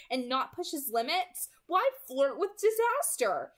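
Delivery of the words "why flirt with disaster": exasperated. A narrator's reading. Exasperated inner question, loud and high.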